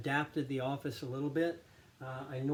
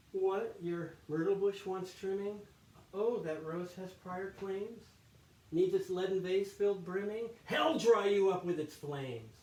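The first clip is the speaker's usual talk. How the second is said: acted-out soliloquy; 6 semitones higher than usual. Monk sneering at Brother Lawrence's gardening, high theatrical scorn. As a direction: mimic the rival's fussing, then damn him. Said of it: "try to act this out".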